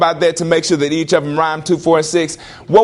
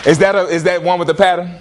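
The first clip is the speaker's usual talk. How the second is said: ordinary talk, nothing marked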